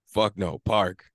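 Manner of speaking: usual speaking voice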